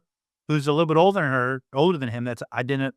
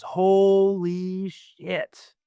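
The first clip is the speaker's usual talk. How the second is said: long drawn-out, raised exclamation; awed child; 4 semitones higher, much slower than usual. Retold or imitated. Six-year-old in the back seat to dad, mock awe. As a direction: stretch the words out slowly in imitated kid amazement.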